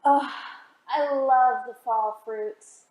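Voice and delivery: ordinary delivery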